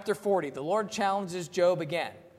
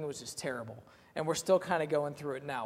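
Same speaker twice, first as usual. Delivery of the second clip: usual speaking voice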